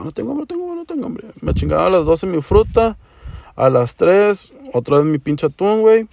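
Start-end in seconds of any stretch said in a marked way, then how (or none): 0.0-1.3 high soft self-talk. Retold or imitated. Telling himself he isn't hungry.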